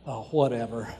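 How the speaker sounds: dropped low and soft, dismissive; a bored brush-off to your spouse; eye-roll whatever. Acted. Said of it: "don't roll your eyes and go".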